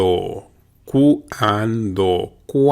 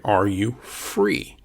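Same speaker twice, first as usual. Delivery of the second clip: ordinary talk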